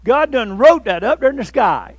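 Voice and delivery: the runner pointing at the sky, loud and excited; frantic insistence. Retold or imitated. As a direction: shout it like the end is here. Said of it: "He said".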